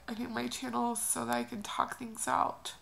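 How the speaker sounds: ordinary talk, nothing marked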